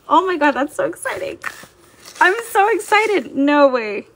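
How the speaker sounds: high, loud burst of surprised excitement; giddy disbelief, pitch jumping up; real excitement. Real, not acted. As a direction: blurt it out, thrilled by sudden news. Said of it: "I got a little excited for that show".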